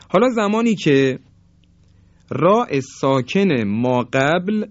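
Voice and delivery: normal talking voice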